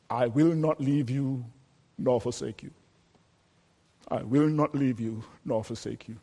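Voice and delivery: low, soft and tender, almost under the breath; a quiet whispered assurance from God; hushed promise. Acted. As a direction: let God's promise sink in gently, very quiet. God's promise, hushed and intimate.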